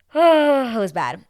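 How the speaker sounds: high, loud, drawn-out dismay; stretched, loud groan into the words; appalled groan. Real, not acted. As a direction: wail it slowly, exaggerating how awful. Appalled reaction to the moment.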